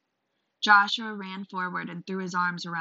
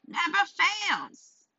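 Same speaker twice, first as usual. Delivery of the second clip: slow, croaky crone warning; old witch voice; old hag; 13 semitones higher, 4 dB louder, much slower than usual. Acted. Crone answers the merchant.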